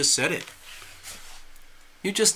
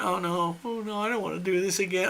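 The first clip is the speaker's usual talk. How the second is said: softer, faster reluctant mumble; reluctant groan; 3 semitones higher, 9 dB quieter than usual. Retold or imitated. Speaker dodging the contest, soft reluctant whine. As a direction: groan it off quietly and quickly. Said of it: "And I went".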